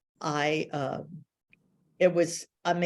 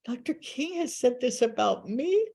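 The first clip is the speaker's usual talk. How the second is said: giddy; 7 semitones higher than usual. Real, not acted. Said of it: "I was on cloud nine".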